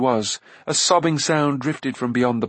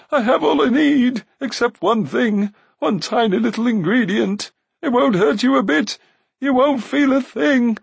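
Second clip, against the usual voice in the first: urgent high plea; pleading Glue; 12 semitones higher, 5 dB louder than usual. A narrator's reading. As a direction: implore in a whiny wail. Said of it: "returned Glue's voice".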